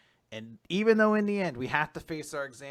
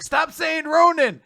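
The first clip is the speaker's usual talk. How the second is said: high, loud, drawn-out protest; exasperated shout; 14 dB louder, slower than usual. Retold or imitated. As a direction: act out a student freaking out at a word. Student snaps at forbidden word.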